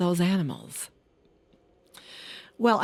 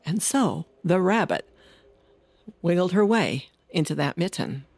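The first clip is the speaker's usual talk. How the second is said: ordinary delivery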